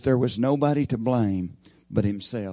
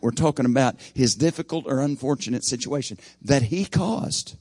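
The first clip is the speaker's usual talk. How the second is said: usual speaking voice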